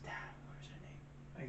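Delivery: real whispered aside; barely audible, voiceless musing; muttering to oneself. Real, not acted. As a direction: whisper it under your breath, searching your memory. Trying to recall a forgotten name.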